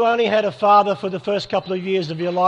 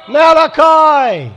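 big, high, drawn-out shout; stretched, high shout of the answer to the crowd; 10 semitones higher, 10 dB louder, much slower than usual. Real, not acted. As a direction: call it out to the hall like a win.

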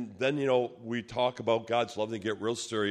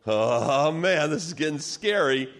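nervous dread; 6 semitones higher, 5 dB louder than usual. Retold or imitated. Nervous thought as threat grows.

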